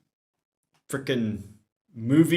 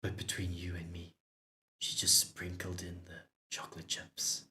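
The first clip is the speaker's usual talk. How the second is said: much softer, a quick conspiratorial murmur; hushed quick confidential aside to the new neighbor; whispered aside; 5 semitones lower, 11 dB quieter than usual. Acted. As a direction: lean in and let him in on a secret. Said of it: "Joseph leans in and whispers".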